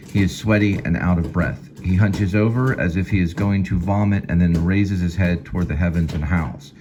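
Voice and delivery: ordinary talk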